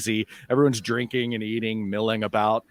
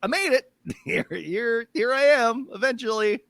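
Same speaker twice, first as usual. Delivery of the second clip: proud high voice; 11 semitones higher, slower than usual. Acted. Hutch proudly claims he made it.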